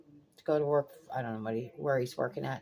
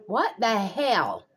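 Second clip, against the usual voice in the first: slow, louder, fed-up demand; exasperated outburst; 6 semitones higher, 7 dB louder, much slower than usual. Real, not acted. Grandmother fuming about grandson's lost headlight, exasperated outburst. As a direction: let the outrage spill out slowly.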